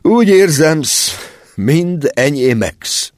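big man weeping at parting; tearful sobbing. A narrator's reading. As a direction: cry through the words of farewell.

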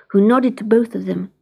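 ordinary delivery

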